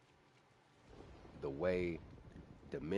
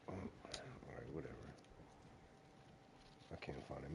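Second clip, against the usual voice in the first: under his breath; 11 dB quieter than usual. Real, not acted. Muttering to himself, giving up search.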